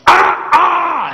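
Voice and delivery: high sharp "Ah!"; attendant shout. Retold or imitated. Narrator imitating the attendant insisting, high sharp shout. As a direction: insist like you won't take no.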